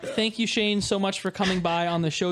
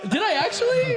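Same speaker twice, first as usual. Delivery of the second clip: much higher, laughing incredulous question; laughing disbelief; 4 dB louder than usual. Real, not acted. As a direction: squeal the question through a laugh. Shocked to learn own mistake.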